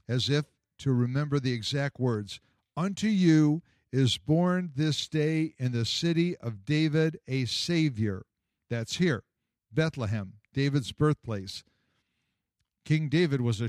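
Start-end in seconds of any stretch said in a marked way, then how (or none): 2.8-8.3 slow, careful recitation; deliberate. Acted. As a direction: say each word as if reciting from memory. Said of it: "The boy spoke deliberately".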